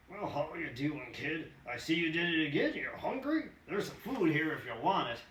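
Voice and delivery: a lightly voiced old hobo welcoming him; old hobo Doc. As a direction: greet the kid like an old drifter. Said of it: "That was Doc".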